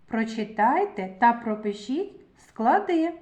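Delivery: usual speaking voice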